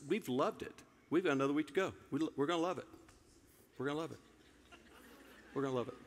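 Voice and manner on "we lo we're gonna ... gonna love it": mock resolve. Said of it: "By faith".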